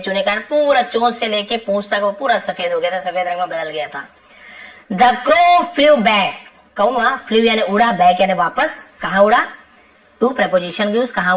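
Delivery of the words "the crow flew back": very slow, word by word, a bit louder; slow dictation. Shown as a demonstration. Teacher reading the lesson sentence to students, slow and deliberate. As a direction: read it out slowly so the class can follow.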